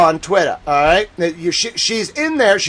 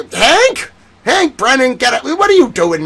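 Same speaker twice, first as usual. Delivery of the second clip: high, louder rising call of the name; surprised call; 7 semitones higher, 4 dB louder than usual. Real, not acted. Host shouting at Brennan on the screen, startled call.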